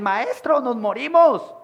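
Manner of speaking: high, loud frightened plea; panicked cry. Acted. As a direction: cry out to the master in terror. Frightened disciples waking Jesus.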